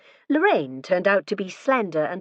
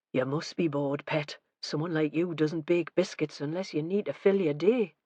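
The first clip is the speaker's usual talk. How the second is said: quiet aside; 6 dB quieter than usual. A narrator's reading. Detective musing softly to the wife about her baking. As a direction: say it under the breath. Vera muses about the biscuits.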